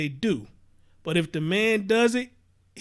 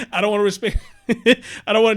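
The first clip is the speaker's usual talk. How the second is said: laughing; 5 dB louder than usual. Real, not acted. Laughs at his own slip.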